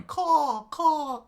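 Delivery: two loud, high caws; pterodactyl caw. A narrator's reading. Narrator imitates the pterodon in the air, cawing. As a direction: squawk it like a flying beast. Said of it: "the pterodon flying out in the air, uh, going".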